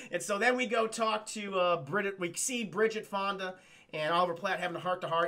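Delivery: ordinary delivery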